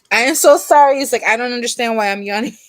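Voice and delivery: laughing apology. Real, not acted.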